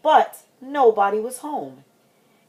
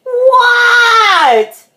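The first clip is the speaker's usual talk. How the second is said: high, loud, drawn-out reply; kid's long wailed shout; yelling back; 8 dB louder than usual. Acted. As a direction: holler back across the house. Child hollers back to dad.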